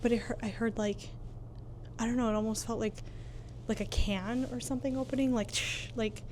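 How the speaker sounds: hushed, nervous voice in the dark; nervous night vlog whisper. Real, not acted. Nervous whisper in haunted hotel.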